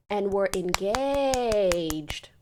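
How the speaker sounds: "engaged" hugely stretched out; emphasis on ENGAGED. Retold or imitated. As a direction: drag out the second syllable of "engaged". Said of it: "more emphasis".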